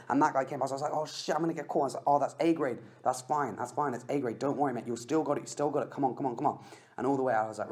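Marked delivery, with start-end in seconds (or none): 0.9-1.8 higher alarmed blurt as a rider passes; sudden panic, pitched up; oh no, caught. Retold or imitated. Rival passes him. 2.1-6.6 reassuring self. Retold or imitated. Self-reassurance mid-sprint.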